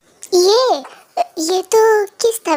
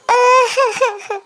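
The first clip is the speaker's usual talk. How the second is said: giggle; 5 semitones higher than usual. Acted. Laugh after kiss confusion.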